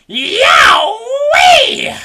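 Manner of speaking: high, loud startled yelp; pained yelp. A narrator's reading. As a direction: shriek like a jolt hit her. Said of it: "Grandma yelled".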